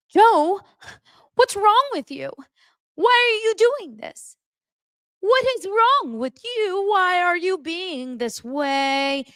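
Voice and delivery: high, drawn-out mock whine; obnoxiously annoying nagging. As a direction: needle him in an exaggerated, high-pitched screech. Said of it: "she was so obnoxiously annoying".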